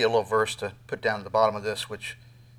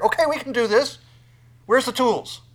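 much higher, louder, busy eagerness; eager bustling; 11 semitones higher, 11 dB louder than usual. Pretend. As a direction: play frantic helpers grabbing tools. Nations acted scrambling eagerly.

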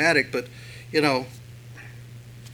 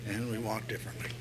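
a hushed little aside; breathy aside; whispered aside; 5 semitones lower, 14 dB quieter, faster than usual. Acted. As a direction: whisper it to yourself.

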